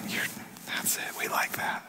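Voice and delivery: very hushed, voiceless murmur of praise; whispered approval. Acted. Followers hearting a post, whispering approval.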